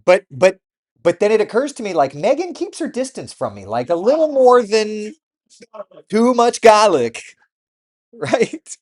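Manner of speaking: loud, high realization; excited rant. Real, not acted. Realizing why a friend keeps away, loud excited.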